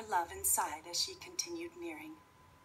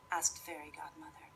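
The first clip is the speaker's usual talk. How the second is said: ordinary delivery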